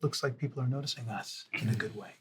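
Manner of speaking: soft aside into a friend's ear; a mild, hushed confidential remark; whispered aside. Acted. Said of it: "I'll just whisper into Tom's ear".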